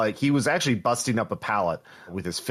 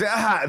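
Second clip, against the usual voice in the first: hit hard crying out; 11 semitones higher than usual. Acted. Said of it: "and he's".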